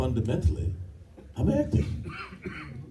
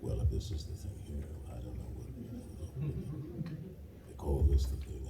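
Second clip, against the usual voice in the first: low, soft mumble under breath; mumbling quietly; 6 semitones lower, 11 dB quieter, faster than usual. Retold or imitated. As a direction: mumble it so it's barely heard. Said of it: "actors who were talking like".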